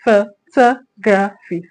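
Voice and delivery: Photography drawn out slowly, syllable by syllable; teacher slowing Photography right down; slow demonstration. Shown as a demonstration. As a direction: spell out Photography slowly for the class. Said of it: "Photography has four syllables".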